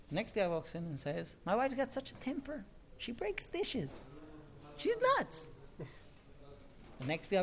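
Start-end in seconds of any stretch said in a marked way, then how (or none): 1.4-5.5 high-pitched mock complaint, climbing at the end; exasperated husband, acted. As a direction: complain about your wife, voice rising high. Acted husband complaining about wife's temper.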